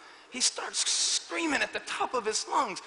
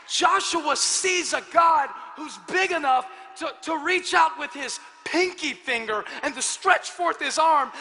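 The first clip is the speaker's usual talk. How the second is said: louder, rising preacher's shout; full-force preaching; 7 semitones higher, 6 dB louder than usual. Acted. Pastor exalting God's power to the congregation, fiery preaching. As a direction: preach it big and bold.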